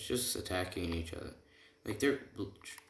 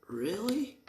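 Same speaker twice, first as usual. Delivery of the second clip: high-pitched rising disbelief; incredulous; 14 semitones higher than usual. Retold or imitated. Group questions the clueless hero, mock incredulous. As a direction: squeak it upward as a stunned question.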